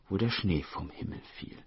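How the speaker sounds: plain reading voice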